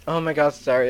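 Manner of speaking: ordinary talk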